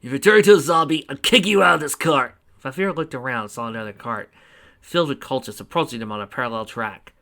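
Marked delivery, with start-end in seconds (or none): 0.0-2.3 louder, sharp warning; loud threat. Acted. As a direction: threaten him loudly. Sarah threatens the necromancer.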